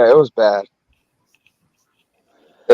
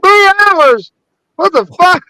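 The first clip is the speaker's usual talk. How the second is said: much higher, squeaky mock outrage; retelling his shock at his jeans shrinking, higher mock outrage; shocked disbelief; slower than usual. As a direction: shriek the number like it can't be true. Retold shock at dryer news.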